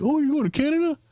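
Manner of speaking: high mock surprised question; surprised agent. Retelling the ticket agent's surprise, high mock voice. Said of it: "she's looking at my ticket like".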